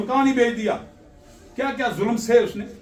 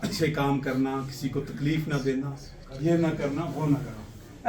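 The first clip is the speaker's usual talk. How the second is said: soft fatherly advice; 6 semitones lower, 6 dB quieter than usual. Retold or imitated. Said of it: "वसीयत करके जाते थे".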